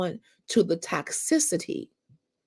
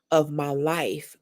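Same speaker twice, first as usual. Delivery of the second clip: usual speaking voice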